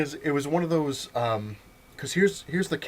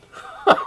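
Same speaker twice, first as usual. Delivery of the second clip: breathy unvoiced laugh; 9 dB louder than usual. Real, not acted. Laughing at the chaos.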